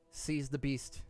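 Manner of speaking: ordinary talk, nothing marked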